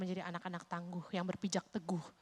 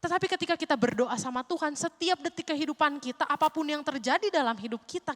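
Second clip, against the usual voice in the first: loud, higher impassioned sermon; fiery preaching; 7 semitones higher, 8 dB louder than usual. Acted. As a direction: preach it to the congregation. Preaching at full force.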